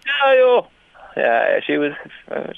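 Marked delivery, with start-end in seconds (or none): 0.0-0.6 put-on high, loud indignant old lady; mock outrage. Echoing the old lady's outrage, high and loud. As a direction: squeal it in offended shock. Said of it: "echoing the old lady".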